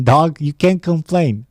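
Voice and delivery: ordinary talk, nothing marked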